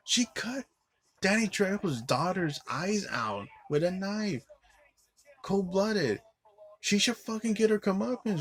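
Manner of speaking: high, indignant outraged rant; getting mad. Real, not acted. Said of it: "I'm getting mad at the end".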